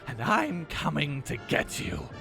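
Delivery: slow mock menace; a hammed-up threat, drawn out word by word; Robespierre. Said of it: "Robespierre crashes in through the door shouting".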